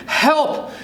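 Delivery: high loud cry; cry for help. Acted. Kid turning to stone, crying out for help. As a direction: call out desperately. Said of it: "You want to cry out".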